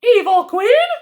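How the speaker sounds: a high storybook granny; grandmother voice. Acted. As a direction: sound the startled grandmother. Grandmother character voice.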